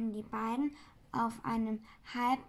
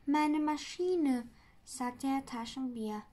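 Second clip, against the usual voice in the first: plain reading voice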